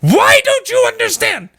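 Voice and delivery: high frustrated shouting at listeners; angry ranting. Real, not acted. Venting at people who don't get it, high angry shouting. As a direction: yell it out in real exasperation. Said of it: "Sorry, I'm getting really angry".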